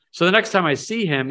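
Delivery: usual speaking voice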